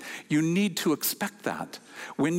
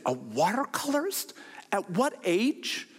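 astonished; 5 semitones higher than usual. Retold or imitated. Shock retold again.

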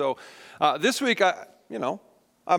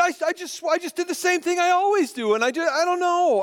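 very high-pitched mock protest; flustered defensiveness; 11 semitones higher than usual. Husband answering wife about the mess, mock-defensive whine. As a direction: stammer out a squeaky, helpless excuse.

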